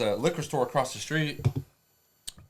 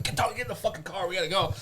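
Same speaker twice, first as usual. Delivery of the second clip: raised pitch, hurried order; urgent retold order; 8 semitones higher, faster than usual. Apprentice rounding up his wild mentor, urgent. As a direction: hustle him out, voice pitched up.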